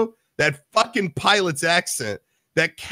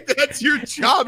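higher-pitched, drawn-out mock scolding; incredulous retort, voice pitched up; mock exasperation; 10 semitones higher, slower than usual. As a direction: tell off a useless colleague, half-joking. Acted crew scolding the medic.

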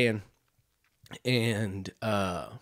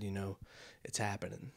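hushed farewell; 2 semitones lower, 12 dB quieter than usual. Retold or imitated. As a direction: drop the volume as if at a deathbed. Husband's mock farewell to fading wife.

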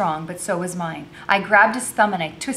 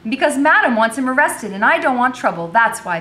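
louder, higher exasperated answer; snapped retort; 7 semitones higher, 8 dB louder than usual. A narrator's reading. Becky explaining her worry to the girl, snapping. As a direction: snap back an impatient explanation. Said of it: "Becky snapped".

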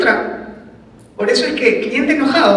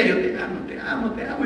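hushed, tender, much softer; soft loving murmur; 10 dB quieter than usual. Pretend. Husband soothing his angry wife, soft tender murmur. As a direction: murmur it lovingly under your breath.

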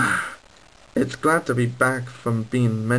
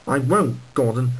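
normal talking voice

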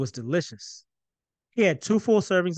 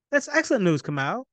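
high, delighted exclamation; exclaiming; 6 semitones higher than usual. Acted. Father exclaims pride at son's news. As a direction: burst out with pride. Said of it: "Jabari exclaimed".

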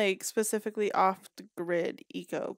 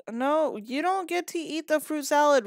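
mock scolding; 5 semitones higher, 7 dB louder than usual. Scolding her Sim for eating.

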